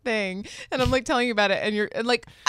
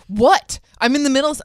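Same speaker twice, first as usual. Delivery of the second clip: louder, curt mock snap; imitating a vile snap; 5 semitones higher, 9 dB louder than usual. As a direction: bark it like you're interrupted.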